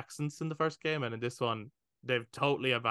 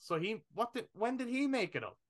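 incredulous question; 9 semitones higher, 4 dB quieter than usual. Retold or imitated. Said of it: "I was like".

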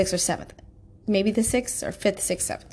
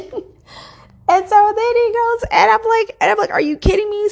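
much higher and louder, exasperated; retelling the fight, high and loud with outrage; worked up; 13 semitones higher, 6 dB louder, slower than usual. Real, not acted. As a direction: let the outrage spill over, pitched way up. Heated retelling of argument.

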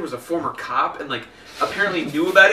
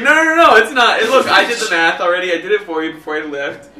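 loud, higher dismissive mock voice; mocking the gaslighting coworker; 4 semitones higher, 8 dB louder than usual. Coworker mocking the manager brushing her off, loud and dismissive. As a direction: brush her off like a smug liar. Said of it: "He's like".

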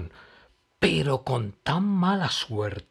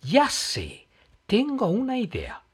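high, bright sudden excitement; happy idea; 11 semitones higher, 4 dB louder than usual. Acted. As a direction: pop up with the idea. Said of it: "saltó Emma contenta".

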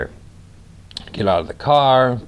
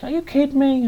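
much higher, incredulous squeal; surprised waitress. Retold or imitated. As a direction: gasp it out in mock disbelief. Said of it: "She's like".

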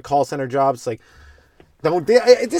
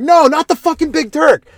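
exasperated pleading; 13 semitones higher, 6 dB louder than usual. Retold or imitated. Desperate customer at gas window.

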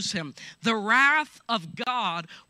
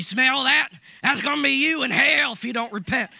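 loud, high mock preacher threat; mock brimstone preacher yelling at the sinner; angry preacher; 5 semitones higher, 7 dB louder than usual. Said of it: "I don't know why I can do that so good".

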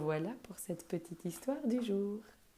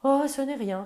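modest reply; 7 semitones higher, 10 dB louder than usual. Acted. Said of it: "répondit modestement le vieux buffle".

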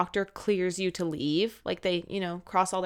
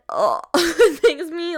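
laughing disgust; 6 semitones higher, 7 dB louder, slower than usual. Real, not acted. Recalling kids eating off the floor.

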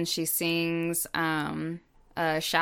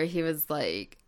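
usual speaking voice

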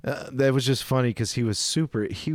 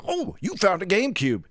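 higher-pitched imitation of nervous interest; mock perked-up surprise, pitched up; perked-up cashier; 7 semitones higher than usual. Cashier spotting the customer's GameCube, imitated nervous perkiness. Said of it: "this nervous look on his face, perked up".

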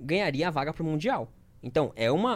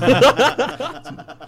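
loud laugh; 10 semitones higher, 12 dB louder than usual. Real, not acted. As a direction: burst of laughter. Laughing at own impression.

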